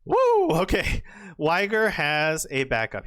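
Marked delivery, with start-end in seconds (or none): none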